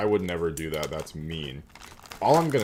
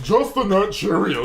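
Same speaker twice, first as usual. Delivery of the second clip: mock imitation of friend; 12 semitones higher, 8 dB louder, slower than usual. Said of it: "this man is just".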